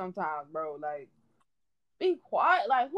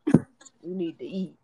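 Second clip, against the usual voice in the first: slow, lower, smug know-it-all voice; mocking quote; 6 semitones lower, 3 dB louder, slower than usual. Mocking a stranger who messaged her to eat, slow and low. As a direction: sneer out the stranger's advice slowly and low. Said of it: "Somebody said that shit in my fucking D M".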